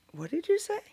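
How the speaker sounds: high, startled question; a mother who can't believe what she heard; stunned disbelief. Retold or imitated. Mother asking her son to repeat, stunned and high. Mom stunned by son's words.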